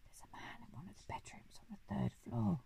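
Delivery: urgent secret whisper. A narrator's reading. Giraffe warning the Duke of a burglar, whispering. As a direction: whisper it very softly, close to the ear. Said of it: "came up very close to the Duke and whispered".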